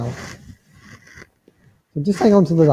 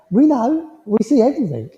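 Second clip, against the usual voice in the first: lightly voiced teasing spirit; 7 semitones higher than usual. Medium voices spirit grandmother.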